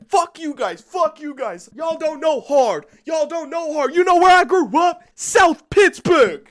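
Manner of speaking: high mock rant building to a loud yell; hammed-up furious outburst; imitated angry screaming. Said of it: "he was just there screaming the F slur".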